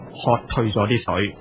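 plain reading voice

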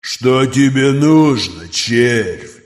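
slow, distant predatory creature; monster voice. Acted. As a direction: speak like a cold ancient beast. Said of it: "спросил Нокрис".

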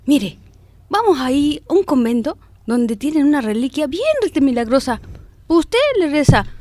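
high, pushy comadre voice; eager comadre. Retold or imitated. Comadre urges her childless friend to the convent, high and eager. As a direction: sell the miracle relic with busybody zeal.

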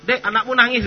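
high, loud call to the wife. Retold or imitated. Husband reading the paper calls his wife, loud high call. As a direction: shout across the room to the wife.

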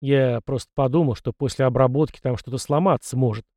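ordinary delivery, nothing marked

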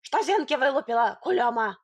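gruff character snapping at her; irritated village-man bark. A narrator's reading. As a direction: bark it rudely, with a push of volume. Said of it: "рявкнул".

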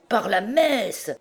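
high, drawn-out exclamation; slow, high-pitched swearing in amazement; interjected oath. A narrator's reading. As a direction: burst in with a hearty oath.

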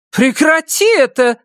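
very high, loud angry shout. Acted. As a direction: shout to stop the noise. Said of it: "услышал крик Сары". (кричит)

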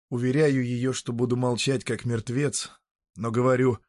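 ordinary delivery, nothing marked

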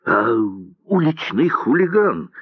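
breathless fury. A narrator's reading. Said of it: "задыхаясь, сказал Морозов".

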